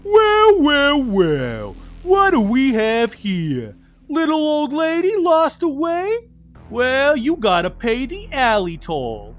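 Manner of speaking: high, slow, loud menacing mugger; thug voice. Acted. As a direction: leer like a cartoon crook. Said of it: "He pulls a switchblade ... and laughs".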